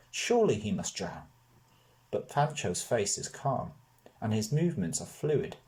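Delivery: ordinary delivery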